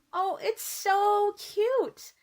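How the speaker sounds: ordinary delivery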